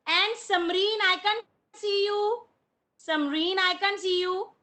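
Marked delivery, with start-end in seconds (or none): none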